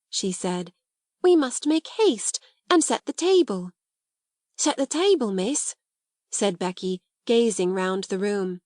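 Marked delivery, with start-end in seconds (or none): 1.2-3.7 higher, bustling call to action; eager urging. Acted. Sarah urges Becky to prepare the party, eager. 4.6-5.7 higher start, rising questioning end; puzzled echo. Acted. Becky questions Sarah about the bare attic, puzzled.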